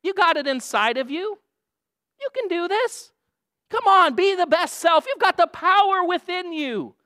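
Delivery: cheesy self-help rally cry; hyped pep talk. Retold or imitated. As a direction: pump them up like a life coach. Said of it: "we usually encourage people like this".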